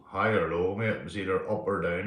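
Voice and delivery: normal talking voice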